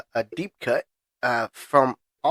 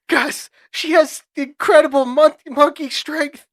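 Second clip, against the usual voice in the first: high-pitched imitation of a man in a fight; mock desperate plea, pitched way up; panicked movie quote; 12 semitones higher than usual. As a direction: cry it out high, mid-struggle. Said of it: "And he goes".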